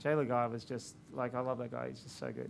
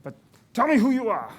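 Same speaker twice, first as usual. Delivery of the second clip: a lightly done booming, higher actor voice, louder; imitating a grand actor; 6 semitones higher, 10 dB louder than usual. Actor greeting the filmmaker downstairs, mock grand and loud. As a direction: mimic the famous actor's lofty greeting, raised and loud. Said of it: "guy said".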